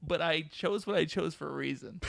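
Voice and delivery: ordinary talk, nothing marked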